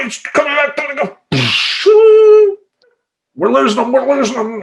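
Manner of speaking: high, loud movie-doctor urgency; mock emergency panic. Doctor to the team as patient slips away, mock panic. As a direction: call out like a hammed-up ER doctor.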